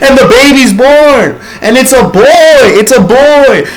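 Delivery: loud, high, laughing cheer; joyful announcement. Real, not acted. Narrator cheering the baby's birth, loud laughing joy. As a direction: celebrate the birth.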